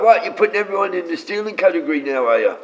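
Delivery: playfully outraged comeback; mock indignant retort. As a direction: throw it back at them, put out. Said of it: "It's like".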